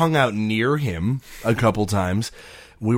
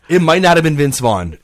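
loud joking disclaimer; 7 semitones higher, 10 dB louder than usual. Real, not acted. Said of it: "by the way, disclaimer".

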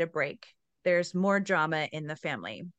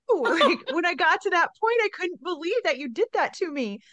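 high, animated playful accusation; hammed-up wounded reader, much higher; mock outrage; 11 semitones higher, 6 dB louder than usual. Real, not acted. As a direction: protest in amused disbelief.

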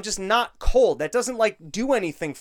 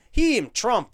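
normal talking voice

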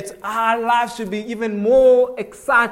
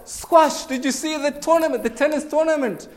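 higher, lively questions tumbling out; eager chat; 8 semitones higher than usual. Retold or imitated. Preacher to Duncan about the cycling race, eagerly. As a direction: pull him in with excitement. Rapid sports questions to Kev.

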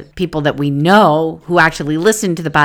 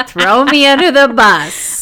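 drawn-out, higher mock indignation; teasing, put-upon whine, stretched long; mock protest; 6 semitones higher, 4 dB louder than usual. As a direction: complain playfully, stretching it out. Jokingly protesting being called out.

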